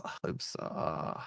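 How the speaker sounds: nervous whispered I hope so. Real, not acted. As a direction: hush it under your breath, hoping. Nervous fan hoping before kickoff.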